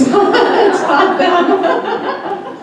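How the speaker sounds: breaking into high laughter mid-word; laughing. Real, not acted. Laughing about the eager client teaching everyone. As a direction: giggle the words out.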